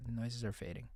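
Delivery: near-silent fading. Acted. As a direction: fade it almost to nothing. Repeated fading line.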